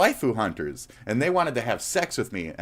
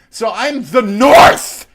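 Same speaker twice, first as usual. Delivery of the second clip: drawn-out furious scream, much higher and louder; war-cry screaming; 12 semitones higher, 12 dB louder, much slower than usual. Real, not acted. Streamer screaming at a rival as the North. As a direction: bellow it like a battle cry. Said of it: "screaming and volatile moments".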